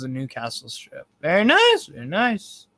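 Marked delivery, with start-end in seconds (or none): 1.2-1.9 much higher and louder, rising mock squeal; high, loud, rising mock praise. Reacts to own story, high pitched. 1.9-2.7 a light silly-voice echo; put-on echo.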